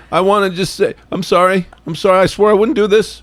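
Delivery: high, choked-up imitation of apologizing; mock tearful apology. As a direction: play someone embarrassed by their own crying. Imitates tearful person apologizing on camera.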